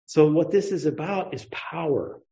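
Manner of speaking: ordinary talk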